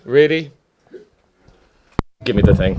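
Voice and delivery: loud protest. Real, not acted. Protesting being given the bomb.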